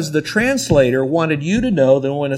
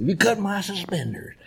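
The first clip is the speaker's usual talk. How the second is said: higher, softer old-timer imitation; older believer; 6 semitones higher, 5 dB quieter than usual. Old professor repeating his catchphrase, higher mimicking voice. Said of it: "That's what older people in the Lord".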